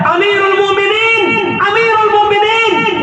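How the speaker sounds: high, carrying call for the caliph. Retold or imitated. Slave calling out to the caliph from outside. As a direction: shout the title to be heard far off. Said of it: "चीख रहा है".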